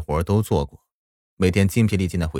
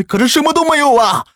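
high, loud anguished cry; despairing wail; 6 dB louder than usual. Acted. Crying man cries out in despair.

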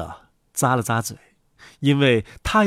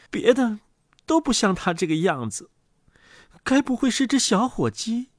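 high-pitched, wondering duck mother; high, doubtful questioning; puzzled mother duck; 11 semitones higher than usual. Acted.

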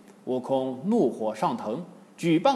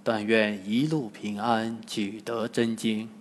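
low, understated praying voice; hushed, lowered wish; silent prayer; 4 semitones lower, 5 dB quieter than usual. A narrator's reading. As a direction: pray privately, pitched down. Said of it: "暗暗祷告".

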